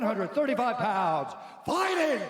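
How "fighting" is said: big drawn-out fight announcer call; booming ring announcer. Acted. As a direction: boom it out like a title-fight announcer.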